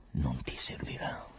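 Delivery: slow, rasping whispered warning; hoarse whisper. Acted. Pilate telling the prisoner prayer won't help, hoarse whisper. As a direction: let the voice go rough and airless. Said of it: "e qui Pilato arrochì".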